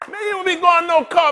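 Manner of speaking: ordinary talk, nothing marked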